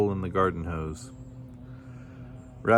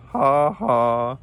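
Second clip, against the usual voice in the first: high, loud spoken laugh; 5 semitones higher, 6 dB louder than usual. A narrator's reading. Kangaroo laughs at Fish in the pond, loud mock laugh. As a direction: read out a hearty mock laugh. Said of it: "he laughed".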